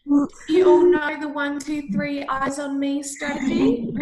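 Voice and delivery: loud classroom teacher voice; kindergarten teacher voice. Acted. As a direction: call the whole class to attention. Teacher addressing class of children.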